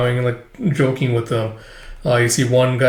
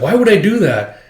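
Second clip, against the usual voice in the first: higher, louder indignant question; indignant retort; 7 semitones higher, 4 dB louder than usual. Retold or imitated. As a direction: bristle at the idea.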